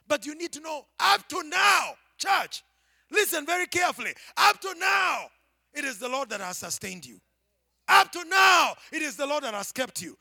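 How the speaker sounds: high, loud, ringing repetition of up to now; full-force preaching. Acted. Preacher thundering up to now at the church, full force. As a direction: build to a preaching climax. Sermon climax, shouting.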